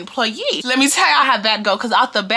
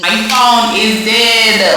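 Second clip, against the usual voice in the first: loud, hugely drawn-out words; booming slow-motion proclamation; dramatic announcement; 7 dB louder, much slower than usual. Acted. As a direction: stretch every word out loudly for drama. Dramatic announcement, then repeated normally.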